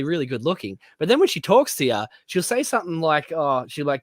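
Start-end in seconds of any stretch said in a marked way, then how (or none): none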